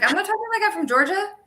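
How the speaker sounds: put-on high, fast, protesting Southern accent. Child denies it to mom, high Southern accent. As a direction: protest in an indignant Georgia twang. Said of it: "I would have a Southern accent".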